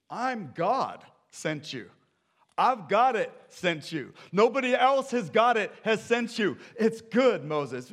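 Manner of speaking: loud, high divine insistence; emphatic God. Acted. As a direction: boom it out to convince a doubter. God insisting to a doubting Moses.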